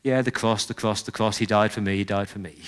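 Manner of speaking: low, soft ho-hum recital; bored. Acted. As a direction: run through it listlessly, barely bothering. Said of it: "a bit sort of ho-hum, a bit bored".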